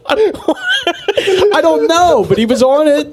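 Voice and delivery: high laughter breaking into the words; giggling I don't know; laughing. Real, not acted. Cracking up over the story.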